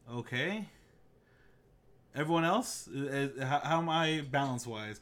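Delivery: usual speaking voice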